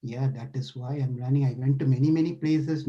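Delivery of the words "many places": normal talking voice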